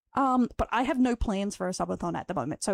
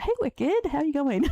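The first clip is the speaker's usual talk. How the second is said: squeaky greeting; 7 semitones higher, 3 dB louder than usual. Real, not acted.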